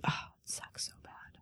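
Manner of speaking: whispered, very soft grief; real whisper. Real, not acted. Owner whispering her grief before changing the subject. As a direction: breathe it out, near tears. Grieving her sick cat.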